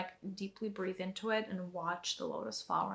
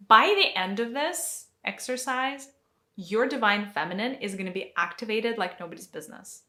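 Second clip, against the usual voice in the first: rousing promise; 2 semitones higher, 8 dB louder than usual. Real, not acted.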